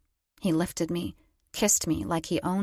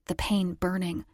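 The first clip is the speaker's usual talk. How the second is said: ordinary delivery, nothing marked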